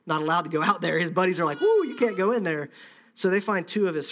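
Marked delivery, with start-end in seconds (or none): 1.6-2.7 higher, raised warning with a whoop; lightly acted alarm; mock alarm. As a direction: play a buddy holding a friend back. Acts out Paul's buddies stopping him.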